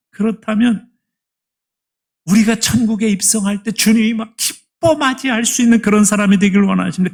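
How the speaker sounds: loud, high, rising sermon voice; fiery preaching. Acted. Pastor urging the congregation toward heaven, fiery preaching. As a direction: press the question on the congregation.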